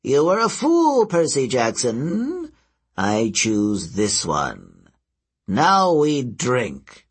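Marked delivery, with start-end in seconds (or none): 0.0-1.1 much higher and louder, triumphant; gloating cry. Acted. Old seer gloats over Percy, loud and triumphant. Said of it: "grinned wickedly". 3.0-7.1 very slow, relishing each word; slow, deliberate. Acted. Old seer makes his choice, very slow and relishing.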